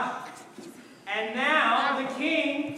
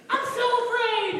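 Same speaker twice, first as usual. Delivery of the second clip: high trembling-scared king, hammed-up; mock fear; 9 semitones higher than usual. King seeing the writing hand, high mock fear. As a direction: wail it like a frightened king.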